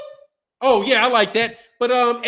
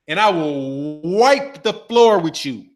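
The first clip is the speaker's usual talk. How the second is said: slow boast; 6 semitones lower, slower than usual. Real, not acted. Boasting he'll out-negotiate on paper.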